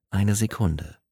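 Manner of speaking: ordinary delivery, nothing marked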